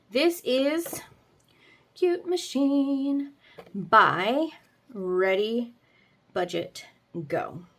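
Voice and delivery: title read out slowly; slow announcer voice. Acted.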